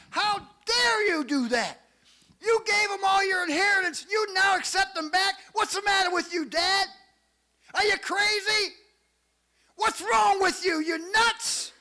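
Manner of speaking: high, loud indignant shouting; furious older son. Acted. Older son confronting his dad over the welcomed-back brother, furious. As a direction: lash out in outraged, high-pitched disbelief. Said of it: "He was mad".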